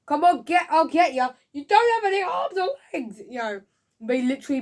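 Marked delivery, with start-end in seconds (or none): 0.1-3.2 high, loud acted taunting; mock combative shout. Reviewer acting out the limbless knight's quarrel, mock shouting. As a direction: yell it like a hammed-up fight scene.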